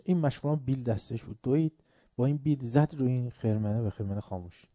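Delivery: ordinary talk, nothing marked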